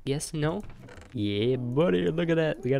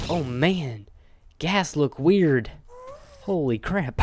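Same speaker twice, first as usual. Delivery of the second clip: startled; 4 semitones higher, 6 dB louder, slower than usual. Real, not acted. Startled by ghasts.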